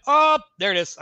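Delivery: loud, high "oh" of doom; mock dismay. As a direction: act out a sudden oh-no moment. Reacting to shattered door, acted.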